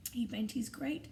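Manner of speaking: ordinary delivery, nothing marked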